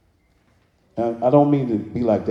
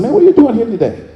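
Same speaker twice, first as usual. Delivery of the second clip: supervisor; 10 semitones higher, 6 dB louder than usual. Retold or imitated. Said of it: "my supervisor said".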